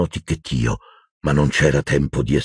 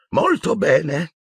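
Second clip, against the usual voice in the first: put-on high, slow and kind old man; gentle old wizard; 13 semitones higher, much slower than usual. A narrator's reading. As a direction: soothe him gently like a grandfather. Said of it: "disse gentilmente il vecchio mago". (vecchio mago che parla con gentilezza)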